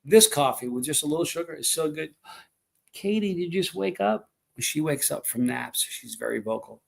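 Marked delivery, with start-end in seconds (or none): 2.9-4.3 pet talk. Real, not acted. Owner greeting the cat just up from a nap, pet talk. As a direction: check in with a pet in a higher voice.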